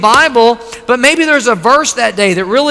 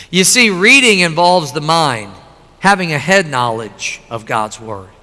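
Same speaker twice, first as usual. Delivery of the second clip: ordinary talk, nothing marked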